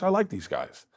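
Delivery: normal talking voice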